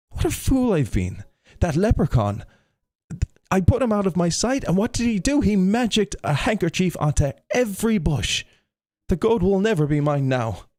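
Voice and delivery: high-pitched, indignant self-reproach; exasperated Tom. A narrator's reading. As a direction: wail over being tricked, pitched well up. Tom rails at being tricked.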